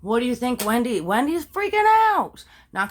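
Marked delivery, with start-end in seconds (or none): 1.0-2.4 dramatic, drawn-out high exclamation; freaking out. Acted. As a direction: sell the panic, stretching it slowly up high. Dramatic telling of Wendy's panic.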